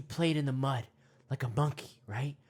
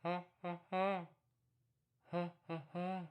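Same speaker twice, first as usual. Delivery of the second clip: distant monkey call; 3 semitones higher, 5 dB quieter than usual. Pretend. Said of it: "a little louder the next time".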